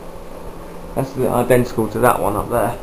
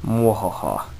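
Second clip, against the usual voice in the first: mad scientist laugh; 2 semitones lower, 4 dB quieter, slower than usual. Acted. Villain laugh over parts box.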